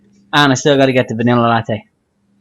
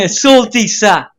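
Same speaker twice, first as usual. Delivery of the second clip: put-on Samoan accent; 8 semitones higher, 3 dB louder, much slower than usual. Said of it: "Samoan accent".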